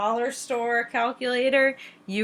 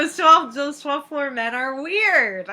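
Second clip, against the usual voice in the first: slow emphatic complaint; 5 semitones higher, 4 dB louder, slower than usual. Real, not acted. Mocking creepy twelfth floor men.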